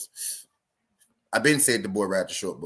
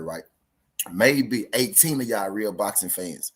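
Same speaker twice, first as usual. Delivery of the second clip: normal talking voice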